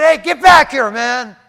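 loud shout after a runaway; angry shout. Retold or imitated. Pastor shouting after the man who bit him. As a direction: yell at him to come back. Yelling after fleeing biker.

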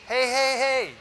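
ordinary talk

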